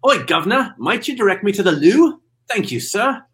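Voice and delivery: hammed-up British accent. Shown as a demonstration. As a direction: put on a wobbly Cockney-style British accent. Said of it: "it was like a roller coaster of accents".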